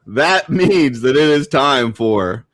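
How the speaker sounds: loud, drawn-out segment intro; show announcer. Pretend. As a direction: build into a big, slow, booming title call.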